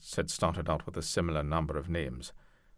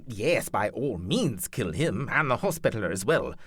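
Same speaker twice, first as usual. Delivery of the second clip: higher, louder lordly voice giving orders; stern duke ordering his knights; commanding duke; 6 semitones higher, 5 dB louder than usual. Acted. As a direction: lay down the terms like a ruthless noble. Duke Leopold orders the killing.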